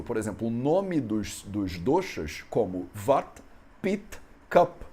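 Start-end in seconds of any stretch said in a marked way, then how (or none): 3.8-4.8 "pit, cup" with the final vowels dropped, higher and louder; Indian clipped ending. Shown as a demonstration. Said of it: "Eles tiram o... da última vogal".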